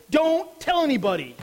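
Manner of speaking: Jesus sharply orders the disciples to keep silent, forceful; emphatic command. Acted. Jesus' sudden command after reversal.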